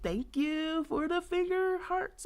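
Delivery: high, slow sing-song thanks; excited thanks. Real, not acted. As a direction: coo thanks to a viewer. Thanks viewer for hearts.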